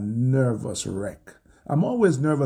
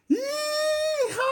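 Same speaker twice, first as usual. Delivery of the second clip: very high, drawn-out yee-haw; screaming cowboy whoop. Retold or imitated. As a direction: whoop it like a wildly thrilled cowboy. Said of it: "And I'm screaming".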